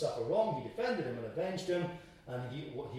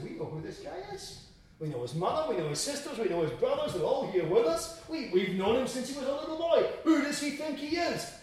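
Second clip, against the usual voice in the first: high-pitched indignant protest, building; who-does-he-think-he-is indignation, rising; offended townsfolk; 10 semitones higher, 6 dB louder than usual. Acted. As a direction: act out a scandalised crowd, piling up objections. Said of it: "They were offended at him".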